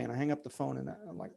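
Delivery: normal talking voice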